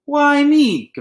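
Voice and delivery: loud high whiny "Why me?"; complaining. A narrator's reading. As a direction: protest like a caught kid. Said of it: "Peter complained".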